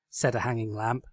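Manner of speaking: ordinary delivery, nothing marked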